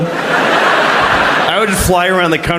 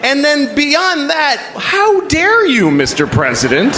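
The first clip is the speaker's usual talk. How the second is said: mock outrage; 9 semitones higher than usual. Comedian rebuking the president on the phone, mock outraged. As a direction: scold grandly, drawing it out high, then dropping.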